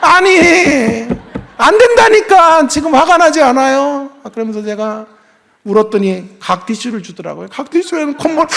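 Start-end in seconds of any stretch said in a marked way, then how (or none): 0.0-4.1 much higher and louder, drawn out; heated protest. Retold or imitated. Father arguing with the principal, loud and high. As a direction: argue back loudly, half laughing at yourself. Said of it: "저한테 왜 화를 내세요?".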